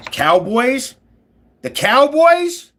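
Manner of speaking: high, slow, loud mocking cry of Cowboys; mocking drawn-out call. Real, not acted. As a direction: stretch out the Cowboys like a taunt. Mocking rival team name.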